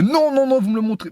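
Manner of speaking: high, sharp mock refusal; a hammed-up high-pitched official; mock stern examiner. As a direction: imitate the examiner snapping no, no, no. Examiner refuses, retold quote.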